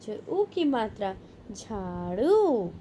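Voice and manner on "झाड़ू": slow blended word. Shown as a demonstration. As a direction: stretch each syllable slowly. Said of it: "spelling drill, slow blended word".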